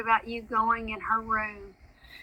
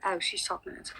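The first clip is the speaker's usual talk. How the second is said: whisper; 10 dB quieter, faster than usual. Real, not acted. Aside during live stream.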